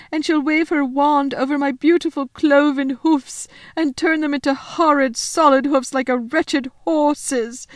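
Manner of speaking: high, loud, wailing faun lament; tearful wail. Acted. Faun listing the witch's punishments, wailing in distress. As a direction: pile up the horrors, close to tears. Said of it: "beginning to cry again".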